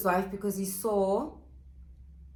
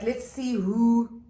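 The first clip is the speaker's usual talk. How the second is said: mock scheming; 3 dB louder, slower than usual. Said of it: "being like".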